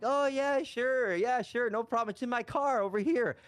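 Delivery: high-pitched, innocent "sure, no problem" act; feigned eager compliance. Retold or imitated. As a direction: play along in a high, over-agreeable voice. Feigned compliance while planning to run.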